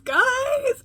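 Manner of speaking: a high, squealing call of guys; excited squeal. Real, not acted. Calling viewers, rising excitement.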